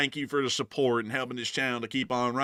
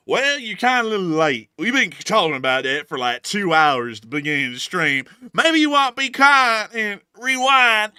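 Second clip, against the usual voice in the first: teasing; 6 semitones higher, 6 dB louder than usual. Real, not acted. Teasing a late viewer.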